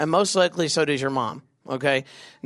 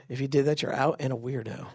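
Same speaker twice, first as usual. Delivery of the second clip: ordinary delivery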